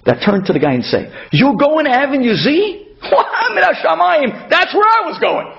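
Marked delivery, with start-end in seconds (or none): 1.3-5.5 mock surprise. Driver pretends he was going there too, hammed-up surprise. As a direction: fake a joyful coincidence, laughing.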